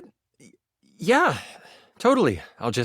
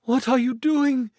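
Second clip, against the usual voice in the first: worried queen, higher voice; 7 semitones higher than usual. Acted. Queen speaks to husband.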